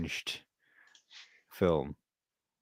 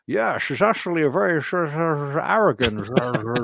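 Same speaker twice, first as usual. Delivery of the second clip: mock Sean Connery, shushing every sibilant; hammed-up Scottish mumble with slurred esses; slurring Connery impression; 5 semitones higher than usual. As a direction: dissolve into slushy "sh" nonsense. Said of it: "And he's just like".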